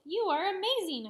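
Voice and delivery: high, loud admiring cry; excited praise. A narrator's reading. Boy praising Jessie after the race, excited. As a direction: gush at the winner.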